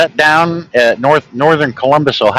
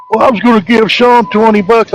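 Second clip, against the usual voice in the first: higher mock voice of the friend; voicing another dad; 11 semitones higher than usual. Dad retelling the friend's offer, in a higher mock voice. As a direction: pitch it up as the other father offering cash.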